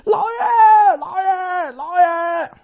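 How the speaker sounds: 拔高嗓门连喊老爷，带哭腔; 像发现主人倒地一样惊慌高喊; 大喊老爷. Acted. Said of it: "大喊". (张三惊慌哭喊老爷)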